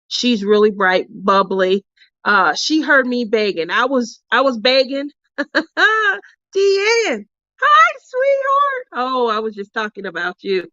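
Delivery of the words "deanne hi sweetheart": very high, slow, drawn-out hi sweetheart; high, slow, loving hello; sweet greeting. Real, not acted. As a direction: sing it out fondly to a friend. Greeting friend arriving.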